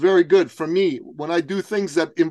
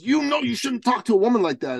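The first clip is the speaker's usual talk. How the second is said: imitated confrontation; 8 semitones higher than usual. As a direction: act out the indignant boyfriend in a raised pitch. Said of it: "gets right in my face and goes".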